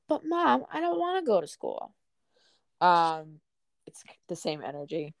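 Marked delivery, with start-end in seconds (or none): 0.1-1.9 put-on high, whining child voice; whiny five-year-old. A little boy begging his mom to stay home, whiny and high. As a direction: whine it like a kid begging mom. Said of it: "like a little five-year-old telling his mom".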